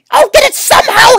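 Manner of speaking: plain reading voice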